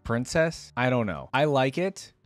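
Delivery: ordinary talk, nothing marked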